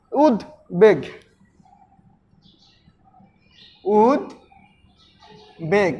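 উদ্বেগ split as উদ, pause, বেগ, the pause widening; two-syllable demo. Shown as a demonstration. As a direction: break উদ্বেগ slowly into উদ and বেগ. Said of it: "এখানে দুইটা অক্ষর রয়েছে". (উদ্বেগ ভেঙে উদ, বেগ)

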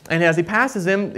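ordinary talk, nothing marked

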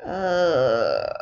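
usual speaking voice

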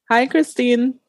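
ordinary talk

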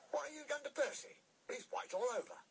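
very high, astonished question; astonished signalman's high-pitched voice; shocked signalman. A narrator's reading. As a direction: exclaim it high in disbelief. Signalman shocked at Percy's state.

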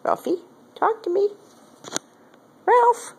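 high-pitched coaxing of a pet. Real, not acted. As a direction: coax the cat in a tiny squeak. Coaxing the cat.

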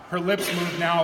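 plain reading voice

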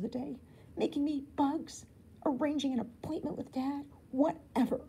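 put-on a girl fuming about Ken; fed up. A narrator's reading. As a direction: vent the grudge, building up. Angry inner rant.

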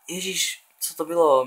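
shocked outburst. Real, not acted. Shocked by a game glitch.

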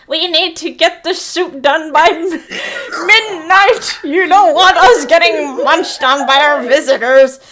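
a hint of a cackling witch. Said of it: "said the witch on the middle".

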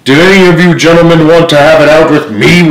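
loud, high-pitched challenge to the crew; roared challenge. A narrator's reading. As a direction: roar it out as a pirate captain. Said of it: "roared Silver".

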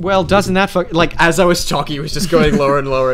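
loud, indignant protest; exasperated outburst. Real, not acted. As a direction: complain loudly over your co-host. Complaining about the sinking microphone.

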